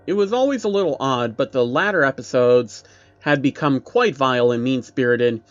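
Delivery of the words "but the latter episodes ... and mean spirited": ordinary delivery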